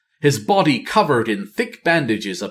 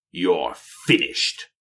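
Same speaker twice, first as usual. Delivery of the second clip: cold; 5 semitones lower, 3 dB quieter, slower than usual. Acted. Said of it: "Nathanos replied, cold".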